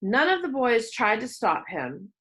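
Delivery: plain reading voice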